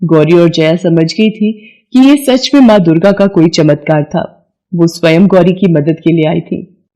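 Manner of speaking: plain reading voice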